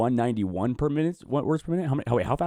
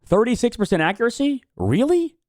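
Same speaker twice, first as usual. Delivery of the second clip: stunned by the bad score; shocked disbelief; 13 semitones higher, 6 dB louder, slower than usual. Real, not acted. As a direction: pitch jumps much higher in disbelief. Shocked at the low test score.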